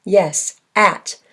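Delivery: ordinary talk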